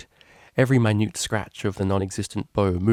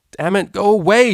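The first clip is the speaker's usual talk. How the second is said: sudden high, loud yell of frustration; 11 semitones higher, 10 dB louder than usual. A narrator's reading. Vinyl yells at her own thoughts, a loud furious outburst. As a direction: shout it out to drive the thoughts away. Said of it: "she yelled".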